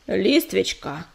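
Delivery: low, slow stern rebuke. A narrator's reading. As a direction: cut her off sternly. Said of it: "с непривычной суровостью отдёрнула её Пепелица".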